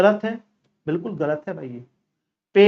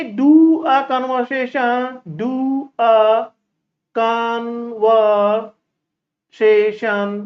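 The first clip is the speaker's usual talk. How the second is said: halting, awkward; much slower than usual. Shown as a demonstration. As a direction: do a conversation stretched slowly and stumbling. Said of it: "एक साथ नहीं बोला जाएगा". (अटकते हुए धीरे-धीरे)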